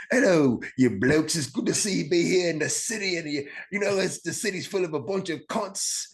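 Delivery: high, lilting mock British accent; put-on British accent. Shown as a demonstration. Comic showing an American crowd loves an accent, high mock British accent. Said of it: "if you come out with a".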